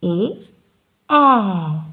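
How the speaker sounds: "ए" said with the mouth wide open; open-mouth a sound. Shown as a demonstration. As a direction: stretch "ए" out with a wide-open jaw. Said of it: "तो हमारा mouth ज़्यादा खुलेगा".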